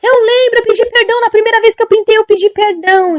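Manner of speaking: high, loud wail, easing slightly at the end; imitated desperate pleading. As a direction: plead frantically for your life. Woman pleads before judgment. (imitando súplica desesperada)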